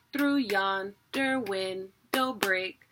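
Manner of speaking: verse broken into slow, separated pieces of THROUGH YONDER WINDOW BREAK. Shown as a demonstration. Teacher showing how to break up THROUGH YONDER WINDOW BREAK, slowly in pieces. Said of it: "that's how you break it up".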